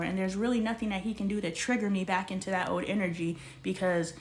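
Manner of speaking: normal talking voice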